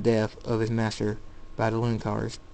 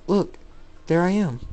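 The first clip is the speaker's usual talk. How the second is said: pointing out; 7 semitones higher than usual. A narrator's reading. David points himself out.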